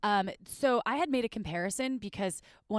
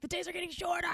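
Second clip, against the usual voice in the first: whiny voice; 11 semitones higher, 3 dB quieter, faster than usual. Retold or imitated. Mock lament about shorter days.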